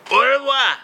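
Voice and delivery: squeaky high au revoir; sing-song farewell up high. Acted. Repeat high goodbye.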